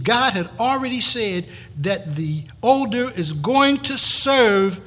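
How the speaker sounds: loud, rising sermon declaration; emphatic preaching. Real, not acted. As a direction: proclaim it with force, pausing on weighty phrases.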